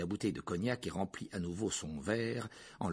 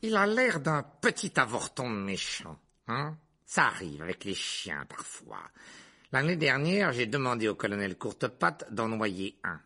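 scornful aunt with swooping higher pitch; overbearing aunt, contemptuous; 6 semitones higher, 5 dB louder than usual. A narrator's reading. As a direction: sneer lightly as a domineering aunt. (tante méprisante et autoritaire)

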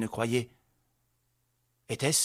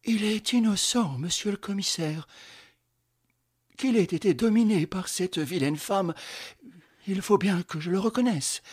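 pleading wife voice; 7 semitones higher than usual. A narrator's reading. Wife pleading husband's innocence.

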